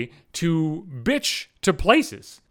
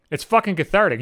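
normal talking voice

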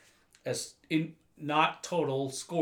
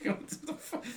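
laughing; 14 semitones higher, 6 dB quieter than usual. Real, not acted. Laughing at Tony's pettiness.

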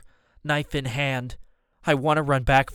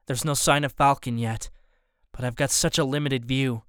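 ordinary delivery

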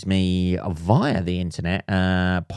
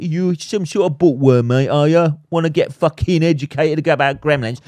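mock taunting bloke; 5 semitones higher, 4 dB louder than usual. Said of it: "like a".

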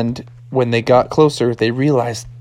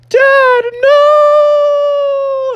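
loud, very high long cry; shrieked storybook panic, last word stretched; hammed-up alarm; 5 dB louder than usual. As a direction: scream after Dad as he falls. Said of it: "he's like".

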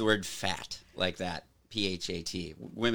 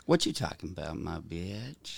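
mock tender; 7 semitones lower, 4 dB quieter than usual. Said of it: "Yeah, I said it. That was lovingly".

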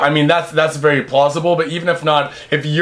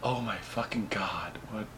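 stunned whisper; 8 semitones lower, 19 dB quieter than usual. Pretend. Imagined clubgoer going from peace and love to horror, whispering. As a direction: whisper it as if frozen in shock.

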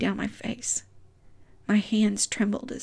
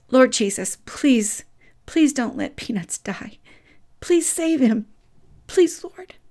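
pleading, rising higher with each please; desperate prayer; 4 semitones higher, 3 dB louder, slower than usual. Retold or imitated. Child praying over dying dog, pleading higher. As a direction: beg God to save the dog. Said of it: "And I prayed".

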